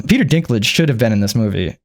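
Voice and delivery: normal talking voice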